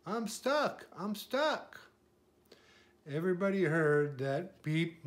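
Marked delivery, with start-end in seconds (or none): 0.1-1.8 higher-pitched mild distress; crying for help. A narrator's reading. Little truck stuck in mud calling out, high plea. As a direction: plead it high, twice over. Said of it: "cried the little blue truck".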